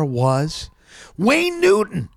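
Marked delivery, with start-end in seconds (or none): 1.2-2.1 high, announced reveal; quick high announcement; big reveal. Real, not acted. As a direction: unveil the name like a punchline.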